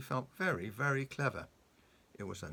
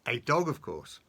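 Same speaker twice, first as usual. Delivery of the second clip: Mister Dizzy's voice; 8 dB louder than usual. A narrator's reading. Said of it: "replied Mister Dizzy".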